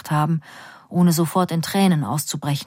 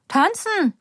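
higher, bright call across the room; cheerful call; 11 semitones higher than usual. A narrator's reading. Mother dancing, calls back cheerfully to her daughter. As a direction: call it back happily, high up. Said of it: "ruft meine Mutter fröhlich zurück".

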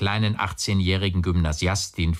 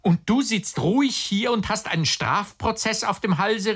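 very high-pitched, indignant exclamation; agitated outcry; 14 semitones higher than usual. A narrator's reading. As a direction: cry out in indignant disbelief. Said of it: "der immer lauter wurde". (aufgebrachter, hoher Ausruf)

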